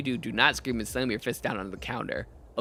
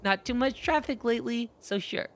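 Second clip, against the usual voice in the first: put-on higher, casual kid clerk; the kid shrugging it off; lightly voiced kid; 9 semitones higher than usual.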